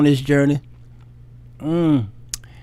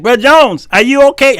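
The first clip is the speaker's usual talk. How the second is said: church mother; 7 semitones higher, 5 dB louder than usual. Retold or imitated. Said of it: "They're like".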